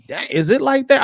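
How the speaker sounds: a touch of mock surprise; mock disbelief. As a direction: act taken aback. Reacting in disbelief.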